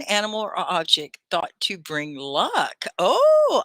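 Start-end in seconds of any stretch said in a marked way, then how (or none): 3.0-3.6 high, loud delighted oh; light up at the answer; big surprised oh. Real, not acted.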